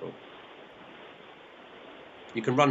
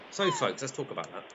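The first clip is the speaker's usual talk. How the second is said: ordinary talk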